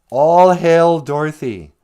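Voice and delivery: loud, slow, high mock proclamation; acclaiming cry. Munchkins hailing Dorothy as witch killer, loud acclaim. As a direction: hail her like a crowd's grand cheer.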